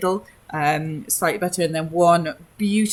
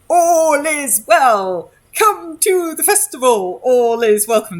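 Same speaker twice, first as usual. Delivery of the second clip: puppet herald; 9 semitones higher, 7 dB louder than usual. Acted. Puppeteer voicing a marionette to the crowd, high hammy herald cry. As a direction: call it out like a jolly puppet herald. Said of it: "marionette going".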